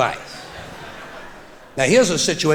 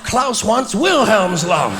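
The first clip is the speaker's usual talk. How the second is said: higher, louder and slow shout; emphatic shout; 4 semitones higher, 4 dB louder, slower than usual. Real, not acted. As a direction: bellow the reveal slowly. Punchline reveal shouted.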